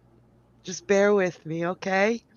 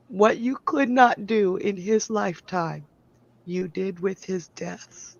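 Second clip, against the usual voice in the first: ordinary delivery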